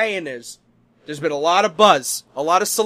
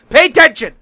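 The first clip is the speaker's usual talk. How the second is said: put-on sharp, much louder and higher bark at the boys; Chef Tetris snaps; 9 semitones higher, 13 dB louder than usual. Head chef snapping at his distracted cooks, loud and sharp. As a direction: snap at the cooks to listen up. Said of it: "Chef Tetris snaps".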